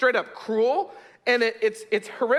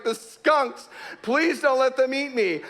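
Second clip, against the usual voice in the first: high-pitched terrified pleading; imitating a frightened wail; mock sobbing plea; 5 semitones higher, slower than usual. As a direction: plead like a panicked boy, hammed up. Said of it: "He's sobbing uncontrollably".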